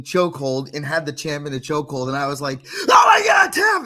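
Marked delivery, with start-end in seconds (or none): 2.9-3.8 high, loud screaming at the fight; yelling for the fighter to tap out; excited scream. Retold or imitated. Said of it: "lost my voice, like, screaming about this fight".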